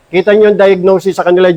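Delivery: ordinary talk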